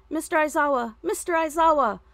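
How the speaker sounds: high, loud, frantic calling of a teacher; a scared student bursting in for help; breathless alarmed shout; panicked panting call. A narrator's reading. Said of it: "one of the girls panted".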